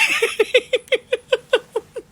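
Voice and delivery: shrill mocking cackle; high laugh. Real, not acted. Taunting the reporter with a high laugh. After calling rival grandma.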